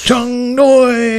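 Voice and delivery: high, drawn-out goofy voice; playful high-pitched catchphrase; silly name call. Pretend. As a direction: sing out the name as a running gag. Reviving the silly name gag.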